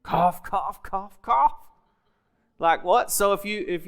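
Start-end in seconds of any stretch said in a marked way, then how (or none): 0.0-1.5 hammed-up coughing; mock coughing. Preacher miming his coughing fit for the congregation. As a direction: cough it out, theatrical and raised.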